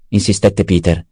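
ordinary delivery, nothing marked

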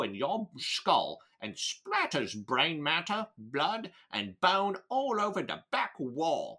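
slow, drawn-out Cockney villain; put-on Cockney British accent, taking it slowly. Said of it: "my ability to do a Cockney British accent".

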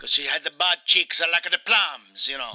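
wronged Italian husband, hammed up; Mario with Italian accent. Mario confides his brother's betrayal, in a hammed-up Italian accent. Plays Mario being interviewed.

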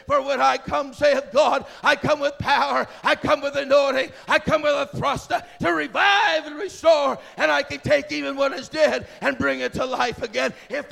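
loud, thundering preaching with stretched key words; shouted prophetic oracle; prophetic declaration. Real, not acted. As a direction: proclaim it as God's own word at full force. Declaims prophecy as God speaking.

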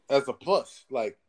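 ordinary talk

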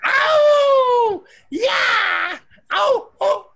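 high-pitched hollered whoops; whooping. Pretend. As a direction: holler it out in long, high yelps. Whooping loudly into the call.

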